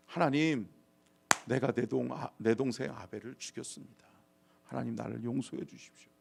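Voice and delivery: soft, humble admission of guilt; subdued repentant prayer; penitent confession. Acted. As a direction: confess quietly and ask for mercy. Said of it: "회개하고 돌이키고 돌아와야죠".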